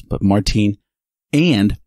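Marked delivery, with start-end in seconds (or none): 0.2-0.8 "Martine" with a long ee, stressed on the second syllable; German pronunciation. Shown as a demonstration. Introducing a new German friend by name, "Martine" said the German way. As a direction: say "Martine" the German way, ending in "teen". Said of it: "That's Martin for us Texans and Americans".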